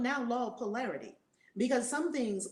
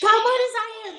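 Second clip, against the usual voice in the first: much higher, louder cheerful hello; host welcoming a guest on air; bright greeting; 13 semitones higher, 9 dB louder than usual. Real, not acted. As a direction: greet her with big energy.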